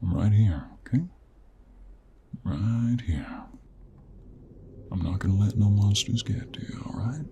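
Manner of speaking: hushed and gentle, lingering on the words; tender reassurance. Acted. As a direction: soothe a frightened animal close up. Soothing the frightened mare.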